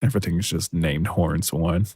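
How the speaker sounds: normal talking voice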